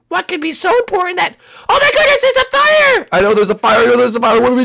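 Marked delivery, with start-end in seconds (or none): 0.1-3.1 high squeal building to a panicked shout; squeaky grumble turning to shrieked alarm; alarmed Captain Toad. Pretend. Sleepy grumbler suddenly seeing the fire, squealing in alarm.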